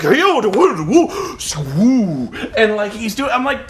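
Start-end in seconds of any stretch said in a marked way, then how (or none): 0.0-2.3 loud woo woo whooshes; mock bending chant. Avatar summoning fog, hammed-up woo chant. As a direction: chant woos while posing. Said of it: "he's like".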